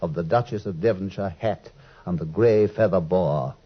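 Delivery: gentle fireside narration; story narration. A narrator's reading. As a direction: lightly draw listeners back to Baker Street. Watson narrates the frame story.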